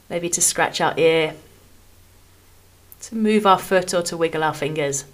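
calm, measured phrases with long pauses between them; guided meditation. Acted. Podcast host guiding listeners through a body scan, calm meditation voice. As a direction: ease each phrase gently downward, leaving room to rest.

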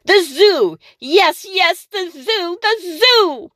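high thrilled witch cry; excited witch. Acted. The witch thinking of the zoo, high and thrilled. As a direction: cheer the zoo idea. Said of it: "she shouted with a jump".

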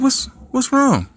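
put-on high, slow worried girlfriend; high concerned girlfriend. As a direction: ask it sweet and high. Girlfriend asks what's wrong.

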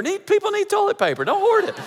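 high-pitched mock scolding; mock outburst. Preacher testing if the congregation is listening, high mock outburst. Joking aside on hoarding toilet paper.

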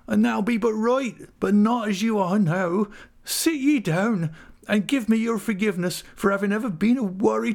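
put-on tearful old widow. Widow Mrs Gummidge soothing grieving Dan'l, high and tearful. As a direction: beg him gently to sit and stay. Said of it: "in a fit of crying".

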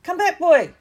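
high, loud cry of alarm; shout. Acted. Madam Hooch shouts up at Neville to come back, high and loud. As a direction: yell after him as he shoots up. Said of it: "she shouted".

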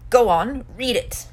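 loud, menacing order; evil hiss. A narrator's reading. Uncle Vernon orders Harry to read, evilly. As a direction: goad with a hard push on the first words. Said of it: "he hissed he-, evilly".

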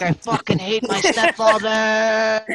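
drawn-out, loud mock rage; mock angry kid. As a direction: play up a resentful kid's outburst, slowly. Mock voicing of resentful stepkids.

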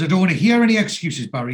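high, loud, quick mock scolding; indignant dismissal. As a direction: shut the excuses down, imitating anger.

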